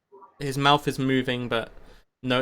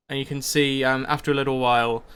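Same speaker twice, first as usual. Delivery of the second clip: normal talking voice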